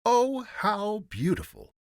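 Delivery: high, loud, drawn-out gushing; exclaiming. A narrator's reading. Courtiers praising the empty looms, exclaiming. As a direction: exclaim it high and slow in admiration. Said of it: "they all exclaimed".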